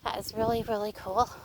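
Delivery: ordinary delivery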